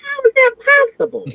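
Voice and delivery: high, incredulous squeak; shocked disbelief. Retold or imitated. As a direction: ask it as if you can't believe it. Disbelief at phone account switch.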